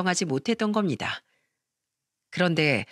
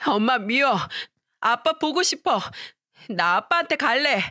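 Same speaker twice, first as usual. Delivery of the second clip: put-on crying child; 5 semitones higher than usual. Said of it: "울기 시작했어요".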